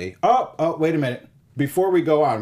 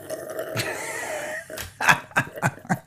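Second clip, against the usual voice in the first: breathy laugh; 6 dB quieter than usual. Real, not acted. Laughs at own joke.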